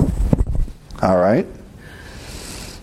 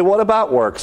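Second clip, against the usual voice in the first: skeptical objector; 6 semitones higher than usual. Retold or imitated. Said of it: "you say".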